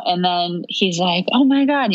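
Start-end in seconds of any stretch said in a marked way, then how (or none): 1.3-1.9 higher, startled but contained exclamation; a jolt of surprise, short of a scream; shocked, not screaming. Retold or imitated. Husband reacts to news the baby's coming, startled. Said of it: "he doesn't, like, scream".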